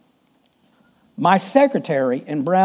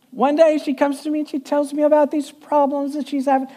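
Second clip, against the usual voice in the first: lightly voiced small sweet voice; 7 semitones higher than usual. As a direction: tell it in her little sweet voice. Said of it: "she kinda talked like this".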